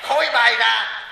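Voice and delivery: ordinary talk, nothing marked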